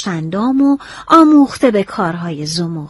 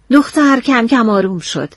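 ordinary delivery, nothing marked

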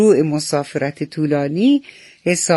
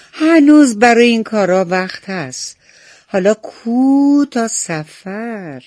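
higher, louder, carefree brush-off; dismissive bird character; 6 semitones higher, 6 dB louder than usual. A narrator's reading. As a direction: put off the work in a raised, lightly voiced bird voice. Lazy bird brushing off preparations.

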